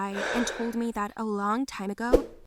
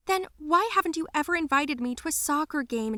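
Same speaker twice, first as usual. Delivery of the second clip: higher-pitched, wounded question; hurt reproach; 7 semitones higher than usual. Acted. Daughter asking why dad never invited her, hurt. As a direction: challenge him, voice raised in pitch.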